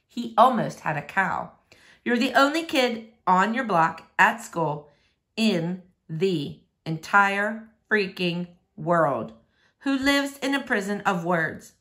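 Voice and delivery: plain reading voice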